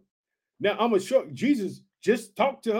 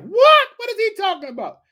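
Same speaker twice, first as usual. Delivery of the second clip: mock disbelief; 11 semitones higher, 7 dB louder than usual. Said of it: "They like".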